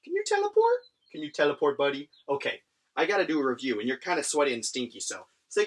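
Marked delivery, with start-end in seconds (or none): 0.0-0.8 very high, sing-song question to the pet. Real, not acted. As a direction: coo it up high like to a puppy. Baby talk to pet.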